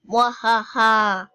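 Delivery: loud, slow hammed-up evil laugh; villain laugh. After vowing to conquer, hammed-up loud villain laugh. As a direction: gloat like a cartoon conqueror.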